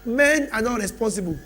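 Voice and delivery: loud, rising sermon bursts with long pauses; preaching at full force. Acted. Preacher promising the congregation change, loud and rising. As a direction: drive the promise home to the church.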